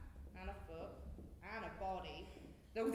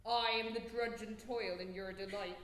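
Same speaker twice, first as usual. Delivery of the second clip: usual speaking voice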